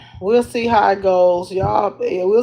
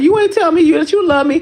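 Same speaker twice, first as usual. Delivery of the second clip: higher, louder mock-whiny accusation; whiny complaint; 8 semitones higher, 4 dB louder, faster than usual. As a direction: complain like a pouting girlfriend. Complaining boyfriend forgot love you.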